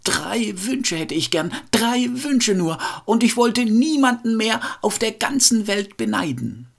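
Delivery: high-pitched, insistent plea; storyteller's woodcutter pleading upward; calling out loud. A narrator's reading. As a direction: call the wish out high and stretched. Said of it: "rief dabei ganz laut".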